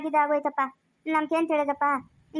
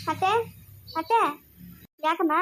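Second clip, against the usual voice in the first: normal talking voice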